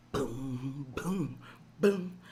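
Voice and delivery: low mouth-drum booms; beatbox the bass under the song; vocal bass beat. Pretend. Beat between sung lines.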